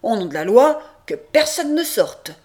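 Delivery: loud order, rising in pitch; official command. A narrator's reading. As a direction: order the room sealed in the law's name. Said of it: "Il ordonne de fermer les portes".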